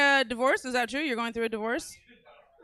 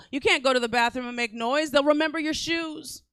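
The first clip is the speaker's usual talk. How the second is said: ordinary delivery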